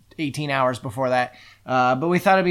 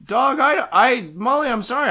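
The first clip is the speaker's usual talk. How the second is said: flustered; 10 semitones higher, 4 dB louder than usual. Acted. Arthur flustered, pitch jumps.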